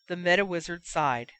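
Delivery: ordinary delivery, nothing marked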